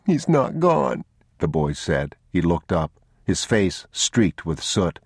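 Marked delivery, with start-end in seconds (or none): 0.1-1.0 put-on high child's insistence; tearful protest. Soot-streaked boy contradicts his father, tearful. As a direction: protest as an upset little boy.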